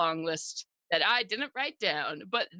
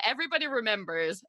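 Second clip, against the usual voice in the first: usual speaking voice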